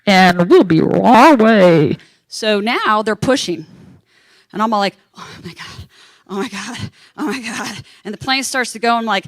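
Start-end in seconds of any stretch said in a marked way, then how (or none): 0.1-2.0 airline captain announcement. Retold or imitated. Captain reassures waiting passengers, loud hammed-up announcement. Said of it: "the captain comes on and goes". 5.2-7.8 whispered, then barely voiced dread; retold hushed panic. Passenger as plane starts pushing, hushed panicky muttering.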